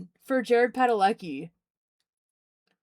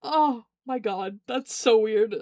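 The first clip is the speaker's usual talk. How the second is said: stunned when the star cuts in behind you; startled; slower than usual. Retold or imitated. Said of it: "I was like".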